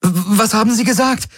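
high, stammering, pressing question; urgent pressing. Acted. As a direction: demand an answer fast. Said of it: "drängte Kirai".

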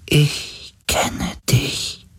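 slow, faint whisper with long gaps; dying being's whisper. A narrator's reading. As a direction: let each word fade like a last breath. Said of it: "Die Stimme eines sterbenden Wesens". (schwach gehauchte Stimme eines sterbenden Wesens)

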